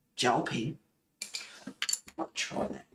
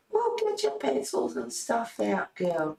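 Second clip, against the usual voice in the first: playful coaxing; 9 semitones higher than usual. Pretend. Coaxing herself to colour.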